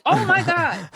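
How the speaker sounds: high, exclaimed amazement; retold gasp of shock in words; astonished. Student floored by what she never heard before, astonished.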